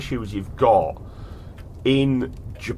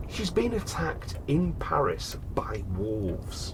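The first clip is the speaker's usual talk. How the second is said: slow, hushed whisper for drama; 9 dB quieter, much slower than usual. Acted. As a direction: lean in and whisper it like a secret. Dramatic aside about wolf attack.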